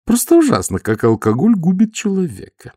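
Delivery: high, fussy lady voice; madame Lada's voice. A narrator's reading. Noticing the smell, high prim lady voice. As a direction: voice a prim lady sniffing the air. Lada tutting over drunk.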